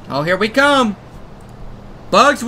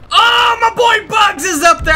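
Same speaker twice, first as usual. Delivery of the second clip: excited shout; 7 semitones higher than usual. Real, not acted. Spotting Bugs in game.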